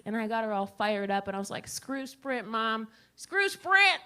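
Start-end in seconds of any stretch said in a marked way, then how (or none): 1.7-4.0 rallying mom. Retold or imitated. Egging mom on, rising.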